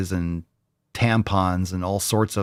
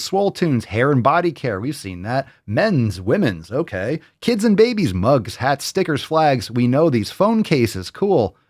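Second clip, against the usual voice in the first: ordinary talk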